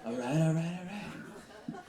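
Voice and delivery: lazy drawl of the catchphrase through laughter; Matthew McConaughey impression. Host mocks his own McConaughey sound, laughing and fading. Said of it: "I sound like Matthew McConaughey".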